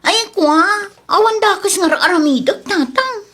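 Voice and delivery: tearful pleading. Acted. Maid begging the madam, crying and pleading. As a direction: plead through tears, voice up high.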